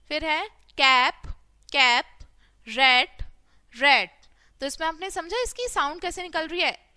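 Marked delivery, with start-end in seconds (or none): 0.8-4.1 loud single-word drill, pause between words; ज़ोर से बोलना. Shown as a demonstration. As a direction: punch out each word for pupils to repeat. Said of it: "इसको जोर से बोलेंगे". (ज़ोर से, रुक-रुक कर शब्द बोलना)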